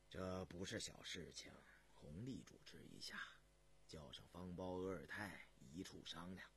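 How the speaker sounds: very low, soft, tired monarch; deep, hushed, fatigued command; weary emperor. Acted. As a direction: dismiss the ministers quietly, worn out. Weary emperor dismisses ministers.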